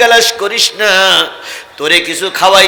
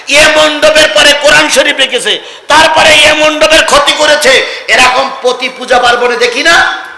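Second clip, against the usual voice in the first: raised, insistent sermon voice; forceful preaching; 8 semitones higher, 5 dB louder than usual. Acted. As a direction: pitch it high and loud to the crowd. (উঁচু গলায় জোরালো ওয়াজ)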